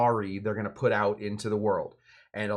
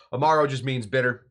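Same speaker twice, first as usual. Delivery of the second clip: normal talking voice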